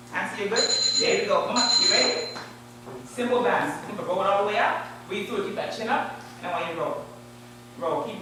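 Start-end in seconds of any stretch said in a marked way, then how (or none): none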